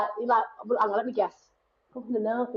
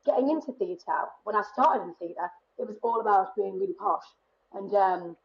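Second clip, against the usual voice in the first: ordinary delivery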